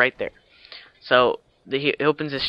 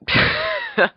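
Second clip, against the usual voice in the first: short laugh; 3 dB louder than usual. Real, not acted. Laughs at own blunder.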